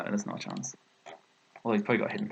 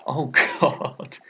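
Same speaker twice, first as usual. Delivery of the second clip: rueful laugh; 4 semitones higher, 6 dB louder than usual. Real, not acted. Laughing at his own blunder.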